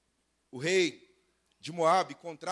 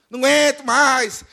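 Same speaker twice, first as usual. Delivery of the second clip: high, very loud fed-up cry; mock complaining; 7 semitones higher, 10 dB louder than usual. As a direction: wail it like a chronic complainer. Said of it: "Gente que reclama por tudo".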